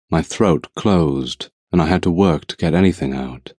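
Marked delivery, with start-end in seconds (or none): none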